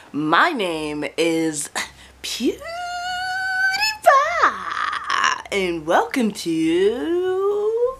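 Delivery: very slow, stretched intro with a high name; drawn-out intro. Pretend. Youtuber greeting viewers, slow drawn-out intro. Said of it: "Say your name in every video".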